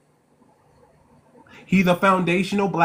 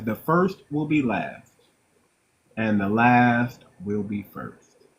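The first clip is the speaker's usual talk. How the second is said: slow, deliberate Bible verse; solemn scripture quote; 5 semitones lower, slower than usual. Retold or imitated. Quoting the Bible to the listeners, solemn and slow. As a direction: quote it slowly, leaving weighty pauses. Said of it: "when it says".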